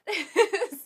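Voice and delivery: loud high laugh that bursts out; burst of laughter. Real, not acted. Knitter laughing about rushing off to watch Star Wars, loud laugh. Laughing at her own eagerness.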